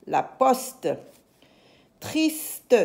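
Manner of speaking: ordinary talk, nothing marked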